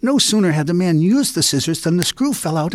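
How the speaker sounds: usual speaking voice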